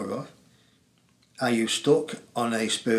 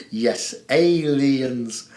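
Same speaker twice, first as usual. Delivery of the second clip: slow mock spooky; 4 dB louder, much slower than usual. Joking about aliens.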